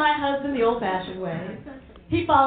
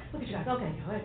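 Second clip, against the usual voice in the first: normal talking voice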